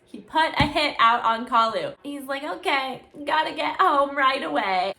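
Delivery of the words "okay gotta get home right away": panicked. Retold or imitated. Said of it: "he starts freaking out".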